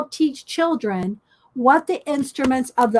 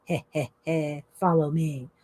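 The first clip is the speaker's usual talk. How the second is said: low, soft, sly chuckle and an invitation; villainous fox voice, lower and softer; 8 semitones lower, 6 dB quieter than usual. Acted. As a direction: chuckle low like a fox leading birds into a trap. Sly fox luring the birds.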